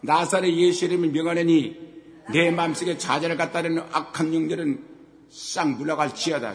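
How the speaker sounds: loud preacher's command casting out spirits; rebuking prayer, loud and firm; commanding rebuke prayer. Shown as a demonstration. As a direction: order the spirits out with full authority. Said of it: "내가 좌절을 쫓아버리란 말이에요".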